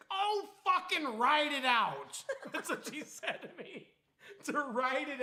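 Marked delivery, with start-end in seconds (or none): 0.1-2.2 much higher, louder, slow and dismissive; annoyed mom. Retold or imitated. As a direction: brush him off, irritated. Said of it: "and she was mad".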